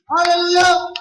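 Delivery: high, full-force preacher's shout; rousing shout. Real, not acted. Preacher telling listeners they are next to be blessed, rousing shout. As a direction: shout to get the whole church clapping.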